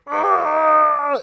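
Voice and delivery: high mock scream; mock pained scream. Mocking the Goblin transformation, a high cry. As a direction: let out a shrill cry of pain. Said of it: "he's just like".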